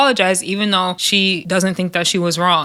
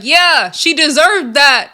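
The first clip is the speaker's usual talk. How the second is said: slow, louder, smug mock gloat; 6 semitones higher, 4 dB louder, slower than usual. [name] gloating from the back, slow and smug. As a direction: drag it out like a smug hypocrite.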